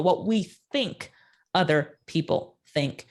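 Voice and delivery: What we think other people think, slow and deliberate; repeated for emphasis. Shown as a demonstration. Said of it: "And I'll say that again".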